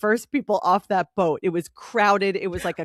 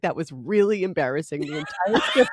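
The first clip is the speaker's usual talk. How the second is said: giggling while imitating him; laughing quote; 5 semitones lower than usual. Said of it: "he's like".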